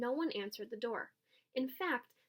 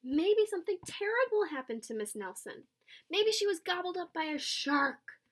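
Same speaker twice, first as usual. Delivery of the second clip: higher, louder anxious guess; worried kid; 7 semitones higher, 4 dB louder than usual. A narrator's reading. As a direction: worry it out loud. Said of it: "said one of the kids".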